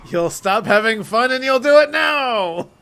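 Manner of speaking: high, loud, drawn-out mock command; hammed-up yelling. Playing the Fun Destroyer ordering kids, over-the-top shouting. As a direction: bellow it like a cartoon tyrant.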